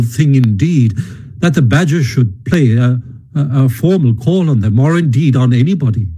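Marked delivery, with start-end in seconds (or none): none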